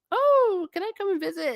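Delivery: very high, put-on wheedling voice; imitating eager visitors. Relatives asking to visit the family in Europe, mock-eager. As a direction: squeal it like a mock-keen would-be guest.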